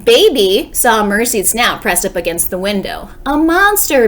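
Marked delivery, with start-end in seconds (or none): 3.2-4.1 high, loud scared cry; frightened shout. Acted. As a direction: shriek at the shape in the window. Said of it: "shouted Baby".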